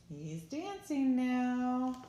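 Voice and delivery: high and slow with delight; excited. Real, not acted. Excited aside to children.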